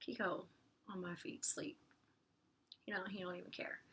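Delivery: soft unvoiced whisper aside; whispered aside off-mic. Real, not acted. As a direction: hush it to the pet beside you. Aside whispering to pet Kiko.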